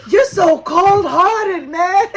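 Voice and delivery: high, drawn-out mock wail; Debbie. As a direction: lament it slowly in a high imitating voice. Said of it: "when Debbie was like".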